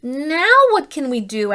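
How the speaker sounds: a hint of a worried moose. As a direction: plead for an answer. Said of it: "asked Moose".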